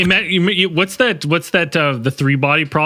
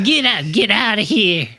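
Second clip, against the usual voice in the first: put-on old-timer shooing voice; cowboy sidekick; old prospector voice; 5 semitones higher than usual. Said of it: "Pretty good".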